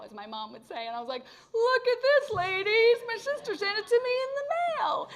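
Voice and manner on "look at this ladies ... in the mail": much higher, louder proud church-lady voice; high, loud mock-proud boast; showing off. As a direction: flaunt the ring to the ladies.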